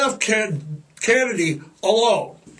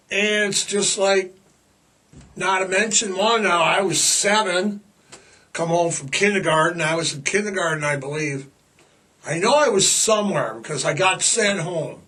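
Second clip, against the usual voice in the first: normal talking voice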